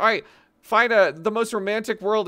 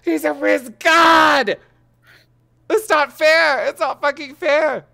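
high, loud laughing outrage; outraged disbelief; 7 semitones higher, 9 dB louder than usual. Real, not acted. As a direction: protest it's unfair. Friend jealous of his success.

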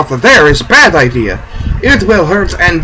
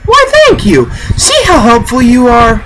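high, sugary mock gratitude; mock sweet thanks; 6 semitones higher than usual. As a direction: thank him in a high, syrupy tease. Mocking thanks to game character.

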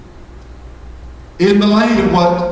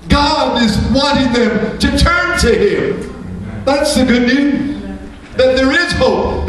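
higher, pleading preacher's plea; fervent preaching; 4 semitones higher than usual. Acted. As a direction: lift it up and plead with the congregation. Preacher rising to climax.

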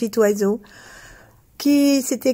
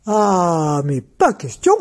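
dim slow booming giant; 2 semitones lower, slower than usual. Acted. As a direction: boom it out like a giant. Giant refuses.

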